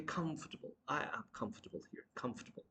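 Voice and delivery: comfortable as "KUMF-tuh-bul"; correct pronunciation. Shown as a demonstration. Teacher repeating softly, comfortable as "KUMF-tuh-bul". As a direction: swallow the middle of comfortable. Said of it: "try to pronounce".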